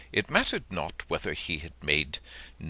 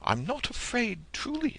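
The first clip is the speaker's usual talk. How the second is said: put-on high young boy's voice, pitched well up; a frightened little boy insisting he's brave; childlike high pleading; small boy pleading. A narrator's reading. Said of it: "said the boy".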